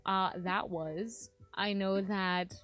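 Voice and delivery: usual speaking voice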